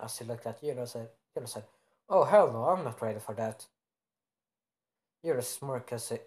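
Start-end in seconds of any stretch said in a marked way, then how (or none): 2.1-3.6 acted loud protest; emphatic refusal. Character refuses in alarm.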